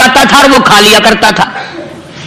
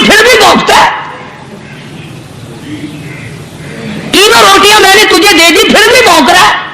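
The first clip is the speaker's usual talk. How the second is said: higher-pitched angry tirade; angry scolding; 6 semitones higher than usual. Retold or imitated. Holy man berating the dog over three loaves, angry and higher.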